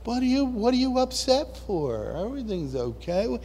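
a touch of prissy calm; mock calm person. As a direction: imitate the smug calm one. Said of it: "the ones that control themselves".

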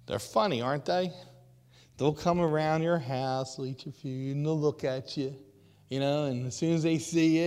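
usual speaking voice

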